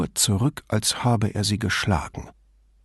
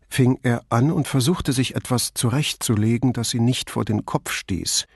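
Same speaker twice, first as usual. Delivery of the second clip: plain reading voice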